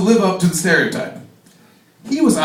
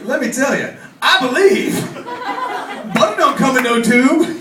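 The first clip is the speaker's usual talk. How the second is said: hammed-up punchline; 6 semitones higher than usual. Punchline delivered as character.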